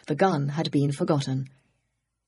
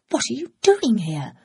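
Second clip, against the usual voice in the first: higher, startled gasp of a question; gasped surprise; 5 semitones higher than usual. Acted. Flora seeing Ed at the cab window, startled gasp. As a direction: caught off guard, voice jumps up. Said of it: "she gasped".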